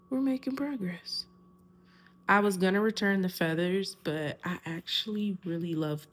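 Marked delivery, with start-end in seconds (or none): 0.1-1.2 whispered aside. Real, not acted.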